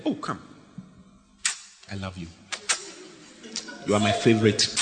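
a hint of a forgiving father; tender God. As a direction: gently welcome them back. God voiced forgiving the cheater.

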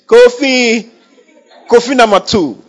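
high, loud mocking call with a laugh; taunting rival. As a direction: jeer at her like the gloating rival. Rival mocking Hannah with sons' names.

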